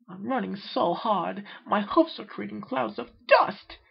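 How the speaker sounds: high, slow prissy complaint; fussy Rarity. Acted. Rarity complains to friends while running, high and slow. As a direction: whine about the dust like a fussy lady.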